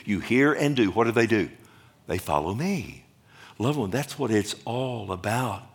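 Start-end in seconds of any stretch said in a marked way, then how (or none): none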